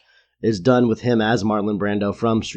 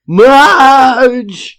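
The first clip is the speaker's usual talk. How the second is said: high, long-drawn shout; bellowed impression of Homer calling his wife; loud Homer yell; 12 semitones higher, 11 dB louder than usual. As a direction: holler it out like an oafish loud husband. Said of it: "loud he is towards her".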